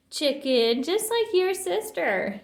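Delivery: high, drawn-out taunt; jeering after him; lightly voiced bully jeer; mock yell. A narrator's reading. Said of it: "yelled Tuffy".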